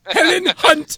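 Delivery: very high, squeaky mock call of her name; squeaky Helen Hunt. Friends joking about a movie, mocking a high squeaky name call. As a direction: squeal the name like a cartoon puppet.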